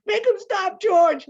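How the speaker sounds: very high, loud panicked wail; terrified cry. Acted. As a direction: cry out begging it to stop. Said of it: "He cried".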